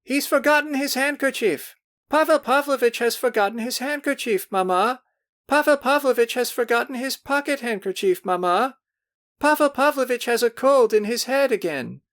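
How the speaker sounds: high, sing-song chorus of mocking girls; crying girls' voices. A narrator's reading. Girls mocking Pavel to their mother, high sing-song chorus. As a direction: chant it like giggling girls calling to mamma. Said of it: "cried voices".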